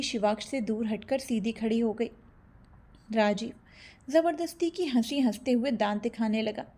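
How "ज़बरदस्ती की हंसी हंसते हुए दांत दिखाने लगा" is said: usual speaking voice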